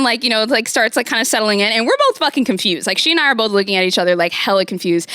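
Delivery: usual speaking voice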